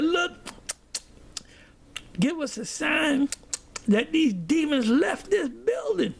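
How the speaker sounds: high, slow imitation of a leader's prayer; solemn invocation. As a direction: call it out slowly, pitched high. Retold leader demands a sign aloud.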